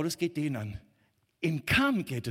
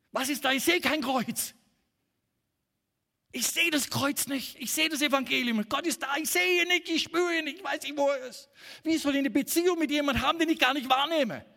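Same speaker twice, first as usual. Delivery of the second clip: much higher, louder anguished cry of someone who cannot see God; desperate doubter; 9 semitones higher, 5 dB louder than usual. Acted. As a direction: plead it out like a lost believer. Voicing the doubter who sees nothing.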